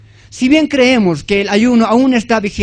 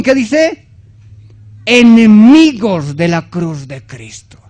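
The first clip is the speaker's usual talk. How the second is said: scripture reading; 3 semitones higher, 4 dB louder than usual. Acted. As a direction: proclaim the verse with long pauses. Reads scripture aloud to congregation.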